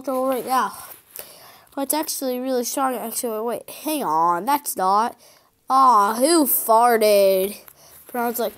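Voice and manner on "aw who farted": loud, slow, disgusted demand; drawn-out outcry. Acted. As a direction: stretch each word out in loud protest. Story character smells gas, protests.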